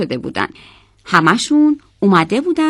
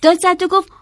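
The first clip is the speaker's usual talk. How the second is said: plain reading voice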